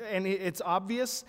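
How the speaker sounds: ordinary talk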